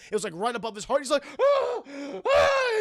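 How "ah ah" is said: two very high mock screams; imitated screaming. Streamer as Billy punctured by a bolt, mock screaming. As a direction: shriek in pretend pain, twice. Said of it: "He's screaming everything".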